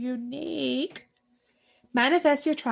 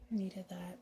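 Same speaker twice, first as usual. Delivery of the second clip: whispering; 4 semitones lower, 12 dB quieter than usual. Real, not acted. Savoring a lovely rose scent.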